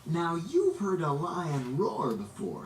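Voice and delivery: ordinary delivery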